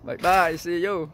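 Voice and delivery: ordinary talk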